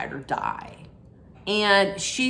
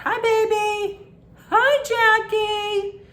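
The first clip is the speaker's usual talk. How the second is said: much higher, slow coo; 14 semitones higher, slower than usual. Real, not acted. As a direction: sweet-talk the dog. Greeting the pet off camera.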